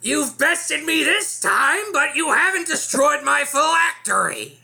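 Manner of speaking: high, slow, shouted lich screech; ancient lich voice. Acted. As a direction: gloat like a defeated undead king. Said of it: "all I can hear is Gilbert Gottfried now".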